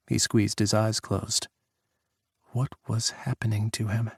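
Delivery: ordinary delivery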